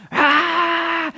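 high sustained "aah" scream; high-pitched running-kid yell; mock child scream. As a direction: imitate a small boy screaming as he runs. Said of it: "screaming, just".

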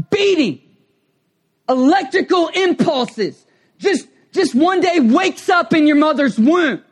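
high, loud climax of the sermon; full-force preaching. Real, not acted. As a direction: preach it out, rising to a shout.

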